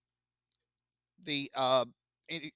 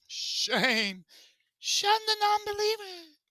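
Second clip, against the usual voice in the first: much higher, sing-song mock chant; meme quote; slower than usual. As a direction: squeal it like a cartoon unicorn. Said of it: "quoting a meme voice".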